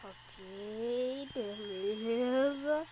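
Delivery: soft, slow self-soothing; quietly talking himself down; calming self down. Real, not acted.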